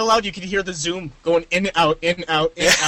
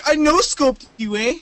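bragging gamer; 8 semitones higher than usual. Retold or imitated.

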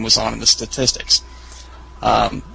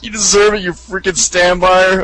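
higher, louder mock gloating taunt; mildly hammed-up gloat; 7 semitones higher, 4 dB louder than usual. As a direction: rub it in. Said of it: "The teammate is like".

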